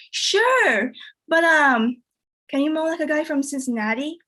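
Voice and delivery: ordinary talk, nothing marked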